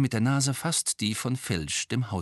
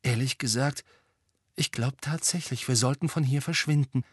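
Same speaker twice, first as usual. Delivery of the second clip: put-on higher-pitched, uneasy girl; mildly jittery, voice pitched up; nervous; 5 semitones higher than usual. A narrator's reading. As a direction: say it anxiously, keen to get away. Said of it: "sagte Hermine nervös".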